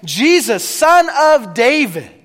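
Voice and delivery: loud cry for help, rising on son; shouted plea. Retold or imitated. Blind beggar shouting for Jesus' help. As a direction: call out to Jesus from the roadside. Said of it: "shout out".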